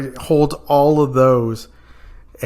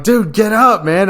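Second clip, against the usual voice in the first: raised, higher urging to get up; urgent call; 8 semitones higher, 4 dB louder than usual. Retold or imitated. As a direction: shout him awake. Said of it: "And so I'm like".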